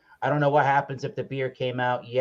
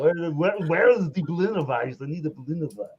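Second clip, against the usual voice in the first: playful mock German accent, slightly higher; fake German accent; 4 semitones higher than usual. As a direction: ham up a German brewmaster asking for his beer. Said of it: "enough of my fake German accent".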